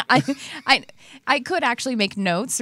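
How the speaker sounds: words said through a real laugh; giggling as she admits it. Real, not acted. Laughs at her own forgetfulness.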